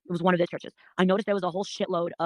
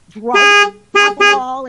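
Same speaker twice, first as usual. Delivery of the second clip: loud outburst; 12 semitones higher, 15 dB louder, much slower than usual. Real, not acted. Ranting about church people.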